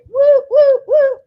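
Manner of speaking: high, loud whooping cheer; excited cheer. Real, not acted. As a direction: whoop it up to kick off the party. Hype cheer to start the party.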